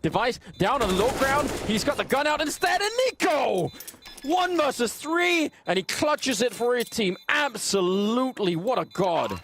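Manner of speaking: rising, yelling clutch call; excited caster. Real, not acted. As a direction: call the clutch at full pitch. Caster calls a clutch win.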